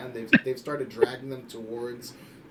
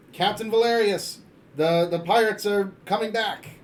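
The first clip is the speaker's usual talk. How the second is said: high, raised cry of warning; lookout's yell; 8 semitones higher, 8 dB louder than usual. Acted. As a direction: call down urgently to the captain. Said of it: "you hear someone yell".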